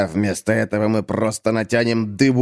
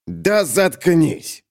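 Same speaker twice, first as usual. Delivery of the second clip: slow, irritated grumble, pitched up; grumpy growl; 7 semitones higher, much slower than usual. Acted. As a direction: grumble it out, dragging the words. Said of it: "буркнул Крошка Пивун". (раздражённо буркнуть, растягивая слова)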